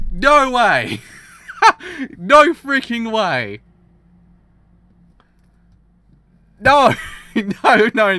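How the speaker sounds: screaming high and loud in disbelief; shocked screaming. Real, not acted. Viewer screaming at a twist ending. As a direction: yell it out, totally floored.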